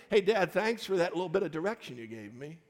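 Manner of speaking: lightly voiced kid gushing thanks to dad; mock grateful child. Kids thanking dad for direction, mock grateful. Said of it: "they say every day".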